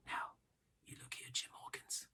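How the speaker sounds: barely audible whisper; urgent secret whisper to Jim; steady whisper. A narrator's reading. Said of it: "in a steady whisper".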